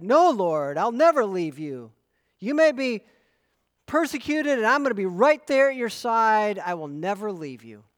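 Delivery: loud, high, brash promise from Peter; bold brash vow. Acted. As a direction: declare it boldly, raising the voice.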